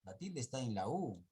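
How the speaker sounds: ordinary delivery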